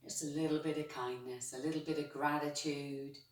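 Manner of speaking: soft, unhurried guiding voice; soothing guided relaxation. Acted. Yoga teacher calming her class, in a soft guiding voice. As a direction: settle the class into stillness.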